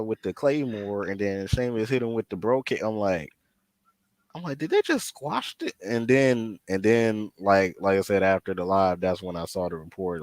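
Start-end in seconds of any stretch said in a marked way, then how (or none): 4.3-5.7 high, incredulous question. Retold or imitated. Fan retelling his shock at a squash match, incredulous. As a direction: pitch it up in disbelief.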